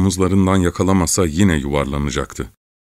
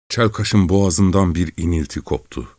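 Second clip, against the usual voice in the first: ordinary delivery, nothing marked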